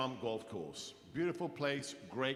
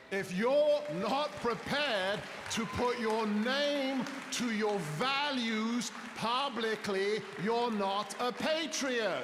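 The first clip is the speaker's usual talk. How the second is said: high, slow, booming rally oratory; loud, drawn-out rallying cry; impassioned declaration; 8 semitones higher, 3 dB louder, slower than usual. Real, not acted. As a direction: thunder it at the crowd, stretching each word. Rallying climax to a live audience.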